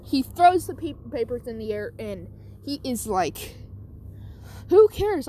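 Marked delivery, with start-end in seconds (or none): 4.7-5.3 high, loud fed-up outburst; mock yell. Teacher throwing papers in the air, loud mock yell.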